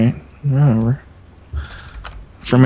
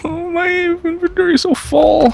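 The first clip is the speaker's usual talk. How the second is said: whiny. Real, not acted. Whiny complaint.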